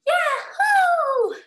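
high, loud whooping cheer; screamed yahoo. A narrator's reading. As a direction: let out a long, high whoop. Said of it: "you should scream".

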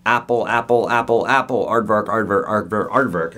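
monotone word-by-word list reading; dictionary recital. Shown as a demonstration. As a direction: drone each word evenly, as if reading down a dictionary. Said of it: "sounds like I'm reading the dictionary".

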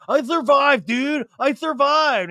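high, loud dude-bro brag. Retold or imitated. Sandoval bragging to Ann, high bro voice. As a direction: boast like a frat guy. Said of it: "He's like".

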